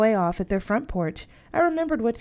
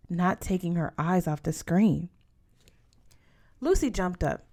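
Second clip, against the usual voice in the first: ordinary delivery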